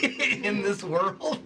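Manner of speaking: slow words through laughter; laughing. Real, not acted. Laughing while telling backstory.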